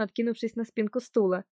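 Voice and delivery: usual speaking voice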